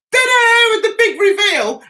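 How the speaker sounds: high, loud showy fanfare; triumphant ta-da. Retold or imitated. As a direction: present it with a flourish. Re-enacting the t-shirt reveal.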